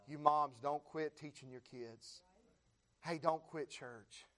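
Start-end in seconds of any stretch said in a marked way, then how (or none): none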